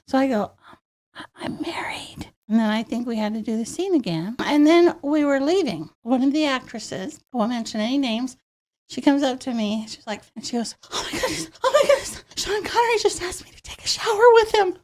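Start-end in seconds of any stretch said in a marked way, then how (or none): 1.1-2.3 bisikan gugup tanpa suara; whispered reply. Retold or imitated. 10.9-14.8 excited gush. Retold or imitated. Actress gushing about Sean Connery.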